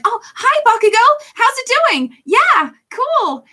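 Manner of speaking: very high, loud, upbeat character voice; Akina. Acted. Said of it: "she's just so upbeat and happy".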